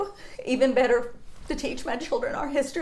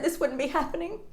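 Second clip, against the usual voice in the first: usual speaking voice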